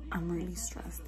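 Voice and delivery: a tired confession in a whisper; stressed whisper. Real, not acted. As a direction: breathe it out, drained. Admitting stress quietly to camera.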